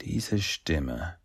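soft, hushed wondering; murmured. A narrator's reading. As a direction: murmur it under your breath. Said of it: "murmelte Leo". (nachdenklich gemurmelt)

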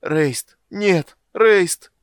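very loud, high cries; escalating desperate shouts; anguished screaming. Acted. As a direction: scream the name in horror. Character screams for his brother.

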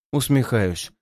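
plain reading voice

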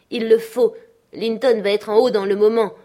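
alarmed insistence. A narrator's reading. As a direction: press the point in alarm. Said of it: "insista l'intrus alarmé". (insistance alarmée)

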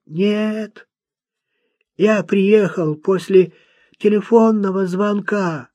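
high-pitched, laboured recital of points; odd, earnest young man. A narrator's reading. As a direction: voice a simple lad trying to speak properly.

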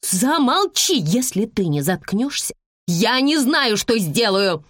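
high, furious woman's outburst; mother losing control, pitch jumping up; frantic yelling. A narrator's reading. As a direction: scream at a child to shut up. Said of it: "Не своим голосом заорала женщина".

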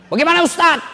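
high, eager commander offering help; eager officer. Acted. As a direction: ask it like a keen officer. (komandan yang bersemangat)

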